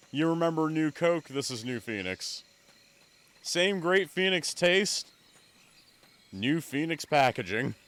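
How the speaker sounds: a playful TV ad read, slowing right down on the taste line; mock commercial pitch. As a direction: sell it like a soda commercial. Mock New Coke ad pitch.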